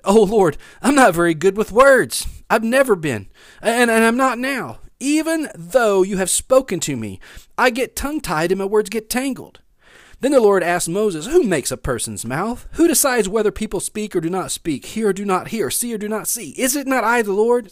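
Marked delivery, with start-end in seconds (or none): 0.0-9.6 raised, insistent plea; pleading. A narrator's reading. Moses begs off to the Lord, pleading. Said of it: "Moses pleaded with the Lord". 11.3-17.7 raised, pressing rhetorical questions; a little frustrated. A narrator's reading. God challenges a reluctant Moses, a little frustrated. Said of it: "hear God getting a little frustrated".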